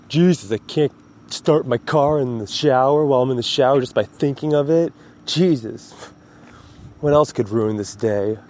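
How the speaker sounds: high, whiny exaggerated complaint; mock complaining. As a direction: ham up a spoiled rich girl's whining.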